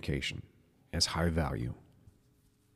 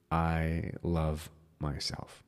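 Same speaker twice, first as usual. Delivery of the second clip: very slow, soft, meant; whispered-soft heartfelt affirmation; deeply felt; 3 semitones lower, 7 dB quieter, much slower than usual. Shown as a demonstration. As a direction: savour every word softly. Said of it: "think about what that love looks like".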